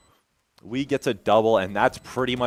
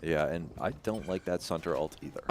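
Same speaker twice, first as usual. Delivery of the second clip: usual speaking voice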